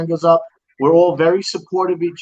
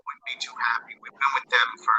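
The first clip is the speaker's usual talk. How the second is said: tin can phone; 5 semitones lower, 6 dB quieter than usual. Real, not acted. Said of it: "You sound like you're talking into a tin can".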